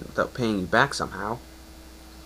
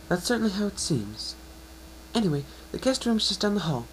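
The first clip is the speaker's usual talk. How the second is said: high, bright-voiced mare, encouraging; a kind unicorn reassuring her patient; Twilight's voice; 11 semitones higher than usual. Higher mare voice, hopeful and gentle.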